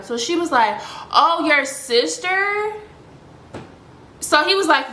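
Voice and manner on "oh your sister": slow, drawn-out, higher-pitched doubt; sceptical questioning. Retold or imitated. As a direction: imitate her stretching out a doubtful question. Acted girl's doubtful reply.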